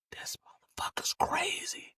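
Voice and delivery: soft unvoiced whisper. Boys whispering to each other in shock, hushed whisper. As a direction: breathe it out in shock.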